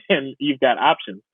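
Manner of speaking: usual speaking voice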